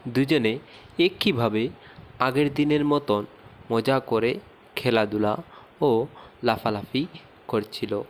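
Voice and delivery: plain reading voice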